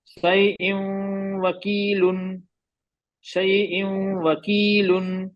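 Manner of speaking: "शैइन वकीलुन" very slow, humming into the w; idgham recitation. Shown as a demonstration. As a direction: model chant, drawing the end of "शैइन" through the nose into "वकीलुन". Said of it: "तनवीन के बाद वाव".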